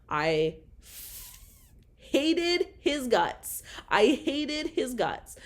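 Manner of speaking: seething. Real, not acted. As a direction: drag out hated with venom. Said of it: "I did not want him to know fucking anything".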